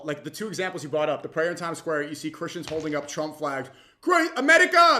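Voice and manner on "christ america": high, loud mocking chant; mock yell. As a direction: shout it like a flag-waving heckler.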